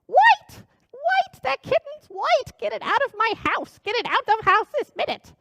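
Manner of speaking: shrill witch screeching. Acted. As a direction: screech like an old hag in a rage. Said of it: "Her voice rose higher and higher".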